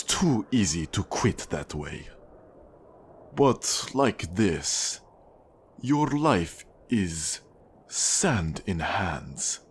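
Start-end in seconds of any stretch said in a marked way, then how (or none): 3.3-9.6 slow, drawn-out menacing words; slow, deliberate villain threat; slow menace. Acted. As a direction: let each word hang as a threat. Villain threatens a new debtor.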